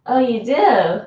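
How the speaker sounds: normal talking voice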